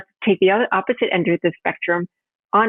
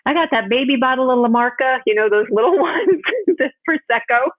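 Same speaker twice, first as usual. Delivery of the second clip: put-on Southern accent; 7 semitones higher than usual. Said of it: "She has this Southern accent".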